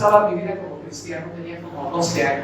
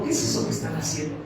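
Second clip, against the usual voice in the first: hushed, quicker whispered question; retold whisper; 5 dB quieter, faster than usual. Enemy's voice to a boy singing in church, retold whisper. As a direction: whisper it like a voice sidling up. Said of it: "les susurra y les dice".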